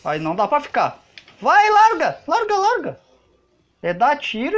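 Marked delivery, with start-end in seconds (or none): 1.4-3.0 high, loud urgent yelling; urgent shout. Real, not acted. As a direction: yell it at the screen in a panic. Urging in tense game moment.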